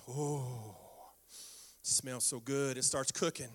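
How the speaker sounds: savoring. Retold or imitated. Savoring the smell of ham.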